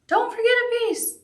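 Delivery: high mock-worried reminder; imitating a worried viewer. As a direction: squeak it out like an anxious student calling a warning.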